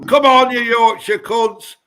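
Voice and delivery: loud, high football taunt; taunting shout. Acted. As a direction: yell it at the rival fans. Taunting rival county fans.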